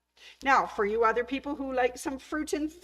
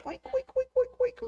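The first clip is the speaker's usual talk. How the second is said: much higher squeaky playful oinking; pig oinks. Pretend. Host voicing the yo-yo pigs for viewers, high playful oinks. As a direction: oink like a cute little piglet. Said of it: "You can just say".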